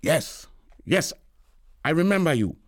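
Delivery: quick, embarrassed; hurried brush-off. A narrator's reading. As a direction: get it over with fast. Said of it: "Harris say quickly".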